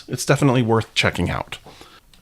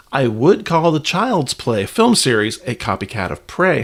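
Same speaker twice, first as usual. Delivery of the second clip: ordinary talk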